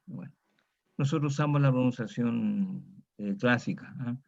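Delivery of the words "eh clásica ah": usual speaking voice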